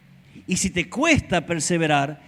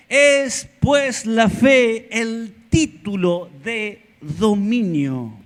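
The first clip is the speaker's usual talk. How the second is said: slow climactic preaching; 3 semitones higher, much slower than usual. Acted.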